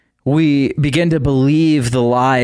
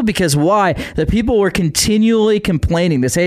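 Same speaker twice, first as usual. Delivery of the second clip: ordinary talk, nothing marked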